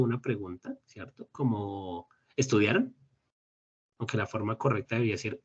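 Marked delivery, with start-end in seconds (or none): 2.4-2.9 "estudiaron" rising at the end; one-word "estudiaron" question with a clear final lift; question intonation. Shown as a demonstration. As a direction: turn "estudiaron" into a question by tune alone. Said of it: "solo con la entonación de la pregunta".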